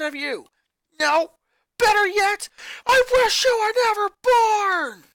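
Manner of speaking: high, loud screaming at her brother; furious yelling. Acted. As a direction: let it all out in a furious yell. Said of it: "Lynn finally yelled".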